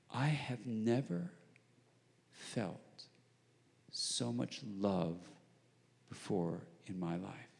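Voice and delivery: slow, soft and hushed, each word weighed; hushed confession. Retold or imitated. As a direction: confide it slowly under your breath, almost reverent. Man confesses deep feeling, hushed.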